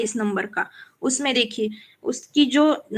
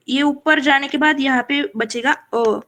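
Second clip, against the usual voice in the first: usual speaking voice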